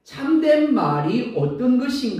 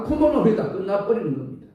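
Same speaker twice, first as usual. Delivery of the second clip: usual speaking voice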